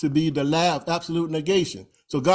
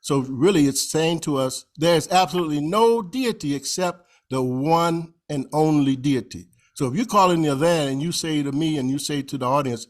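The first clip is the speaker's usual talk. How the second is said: normal talking voice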